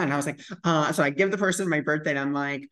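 normal talking voice

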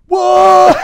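shrieked high What; shocked shout. Retold or imitated. Peter shrieks in shock at being found out. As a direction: scream it in total shock. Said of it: "And he's like".

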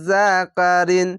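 slow drawn-out recitation of জাকারিন with nasal ghunna; Quranic recitation. Shown as a demonstration. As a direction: chant জাকারিন slowly, holding the nasal. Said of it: "এখানে ইকফা গুন্না".

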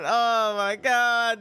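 usual speaking voice

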